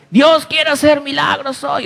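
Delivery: high, strained imitation of a shouting preacher without real volume; mock preacher's shout. Pastor imitating a minister promising miracles, mock shouting. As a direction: mimic a yelling miracle preacher at held-back volume. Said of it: "Estaba gritando, ¿no? Yo no voy a gritar".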